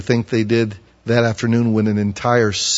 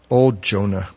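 ordinary delivery

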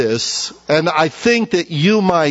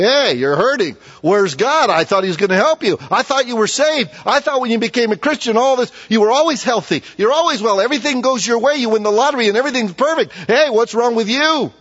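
higher, fast sneering mockery; mocking taunt; 7 semitones higher than usual. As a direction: sneer at the sufferer. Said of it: "people mock and say".

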